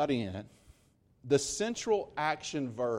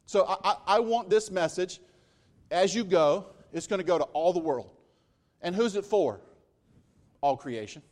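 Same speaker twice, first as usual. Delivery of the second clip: raised, louder sermon delivery; impassioned preaching; 4 semitones higher, 4 dB louder than usual. Real, not acted. Pastor pressing the message on listeners, raised and fervent. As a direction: keep the fire going.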